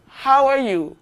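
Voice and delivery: ordinary talk, nothing marked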